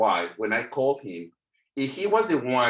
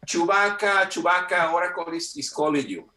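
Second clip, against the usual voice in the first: raised, higher call easing back down; 6 semitones higher than usual. Retold or imitated. Office colleague summons Chewbacca to the phone, calling out. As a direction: call a colleague over, pitched up at first.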